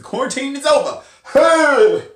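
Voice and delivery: high, loud celebratory shout; quick high whoop ending on a big "Hey!"; excited cheer. Acted. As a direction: burst into a loud cheer for good news. Celebrating the end of quarantine.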